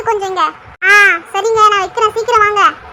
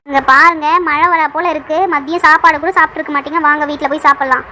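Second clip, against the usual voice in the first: ordinary talk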